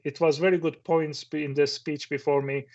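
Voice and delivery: usual speaking voice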